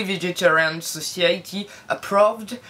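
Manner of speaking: put-on slow, deliberate English accent on Vegetarian Society Approved. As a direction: show off an English accent on purpose. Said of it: "mon accent anglais". (accent anglais appuyé, exprès)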